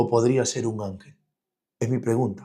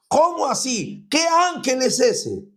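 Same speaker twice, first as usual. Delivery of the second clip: much higher, drawn-out doubting question; incredulous objection; 11 semitones higher than usual. Acted. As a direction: voice a skeptic who can't believe it, rising high. Preacher voices a doubting listener. (pregunta incrédula, mucho más aguda)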